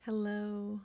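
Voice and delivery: normal talking voice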